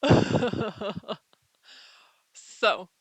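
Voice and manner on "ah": short loud playful scream; mock scream. Vlogger screaming in mock alarm at viewers. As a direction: yelp in hammed-up alarm.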